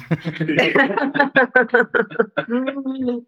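high, long burst of laughter; real laughter. Real, not acted. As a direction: crack up for real. Reacting to the joke.

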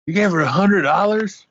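high-pitched mock disbelief; retold incredulous question. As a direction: imitate someone astonished at the sum. Father's disbelief, retold as punchline.

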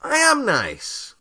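high, slow offended insistence; indignant protest. Real, not acted. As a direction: squeak out an offended denial.

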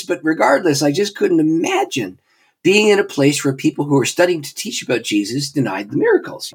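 normal talking voice

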